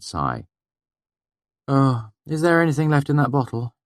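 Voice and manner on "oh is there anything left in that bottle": higher, tired plea; weary Denner. A narrator's reading. Denner asking the narrator for drink, weary. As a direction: ask for the bottle wearily. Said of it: "she asked wearily".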